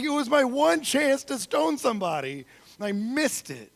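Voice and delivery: high, loud exasperated wail, played for laughs; loud mock lament. Author bemoans his one chance to stone somebody, loud mock lament.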